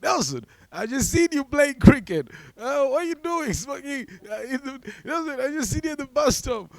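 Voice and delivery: hammed-up high-pitched greeting, mostly loud; excited classmate impression. Mistaken classmates greeting him everywhere.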